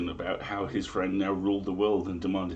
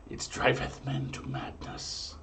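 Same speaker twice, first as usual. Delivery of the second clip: hushed conspiratorial whisper; 4 semitones higher, 5 dB quieter, slower than usual. Acted. Host whispering the omen's punchline to listeners, whisper. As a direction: drop to a secret whisper for the twist.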